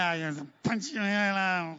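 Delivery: mock whiny squabbling child; drawn-out kiddie whine, imitated; whining kid. Preacher mimics kids fighting on the drive to church, mock whining.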